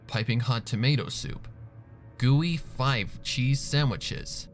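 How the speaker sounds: ordinary delivery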